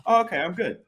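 high-pitched child imitation; reassured little kid. Fallen child takes parent's cue, high put-on kid voice. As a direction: play a toddler deciding all is fine. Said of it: "they're like".

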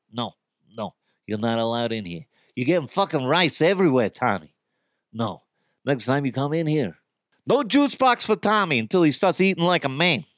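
put-on lightly done Italian wiseguy scolding a toddler; a hint of a mob boss laying down the law; mild mock Italian gangster warning a kid. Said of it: "that is my Italian gangster voice".

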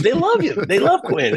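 wife reassuring her husband, in a high gushing voice; imitating his wife. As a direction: play up the wife's delight. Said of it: "She goes".

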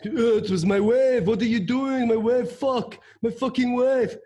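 put-on high, loud French-accented rant; mock an irate Frenchman yelling in accented English; imitated yelling. Surfer imitating an angry Frenchman yelling about his wave, indignant shouting. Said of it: "then he starts yelling at me".

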